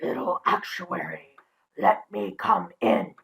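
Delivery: hushed, slow wolf pleading. Acted. Wolf voice at the door.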